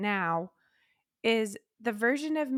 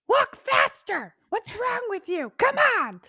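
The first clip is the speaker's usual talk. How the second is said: nagging drill sergeant; 11 semitones higher, 5 dB louder than usual. Retold or imitated. Inner critic shouting at the parade to walk faster, high and loud. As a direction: yell at the parade to hurry up. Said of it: "the one that's like".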